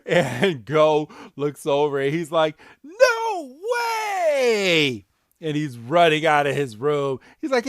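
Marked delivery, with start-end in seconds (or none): 2.8-5.1 much higher, loud cry of disbelief; a kid yelping at something impossible; shocked disbelief. Retold or imitated. Retelling Go seeing Rillaboom, a high shocked yelp.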